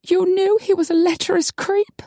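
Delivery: high, wounded accusation; hurt, near tears. A narrator's reading. Betrayed woman to her friend, hurt and near tears. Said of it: "Maggie's lips trembled".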